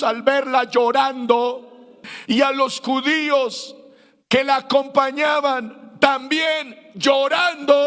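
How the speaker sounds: ordinary delivery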